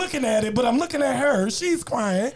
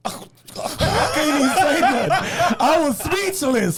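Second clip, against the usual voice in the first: laughing high through the words; 8 semitones higher, slower than usual. Real, not acted. Laughing as he tells friends he was speechless. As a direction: crack up while saying you were speechless.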